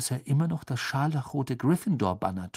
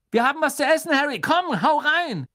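eager schoolgirl; 6 dB louder than usual. Acted. Girl urges Harry to eat.

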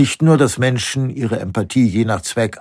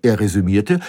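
usual speaking voice

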